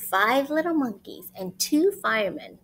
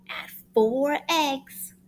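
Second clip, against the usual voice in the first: slow recipe read; 3 semitones higher, 4 dB louder, much slower than usual. Acted. Reading recipe step.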